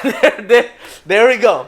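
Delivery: loud, high hype-man call; cheering the guest on, loud and high; hype intro. Real, not acted. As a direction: pump up the crowd for the guest. Hyping up the guest's entrance.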